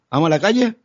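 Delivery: high, sing-out offer to a pet; inviting a dog out. Retold or imitated. Owner offering the dog a walk, high inviting call. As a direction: lift it high to get the dog's attention. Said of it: "si es el perro y le dices".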